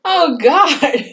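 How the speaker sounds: high, laughing exclamation; amused dismay. Real, not acted. Recapper cracking up at a grim plot twist, high amused dismay.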